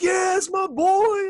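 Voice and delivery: high, drawn-out celebratory yell; freaking-out dad. Retold or imitated. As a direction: imitate a dad cheering wildly for his kid. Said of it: "the dad's freaking out".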